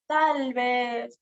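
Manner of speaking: drawn-out, hesitant musing; acted unsure pondering; doubting. Shown as a demonstration. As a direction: demonstrate uncertainty by stretching the words.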